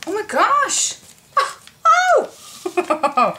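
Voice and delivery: high, loud delighted shriek; excited shriek. Real, not acted. Excited discovery.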